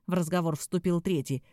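ordinary delivery

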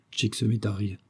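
ordinary delivery

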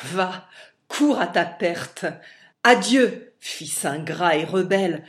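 loud furious shout; furious dismissal. A narrator's reading. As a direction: hurl him away in rage. Père chasse son fils, furieux. (colère criée, très forte)

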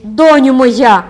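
a mother's stunned cry, drawn out; anguished cry. A narrator's reading. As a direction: wail it out from the depths of the soul. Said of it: "скрикнула голосом, що вирвався із самих глибин".

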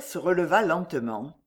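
plain reading voice

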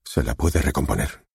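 ordinary delivery, nothing marked

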